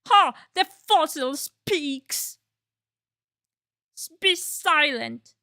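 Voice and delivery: slow, scornful villain voice; pumpkin voice. Acted. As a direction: sneer it out at crawling pace. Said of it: "I keep the voice".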